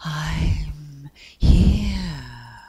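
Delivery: airy, drawn-out sighing wind voice; long, blowing sigh of a reply. A narrator's reading. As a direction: sigh the words out like a breeze. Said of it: "sighed Wind".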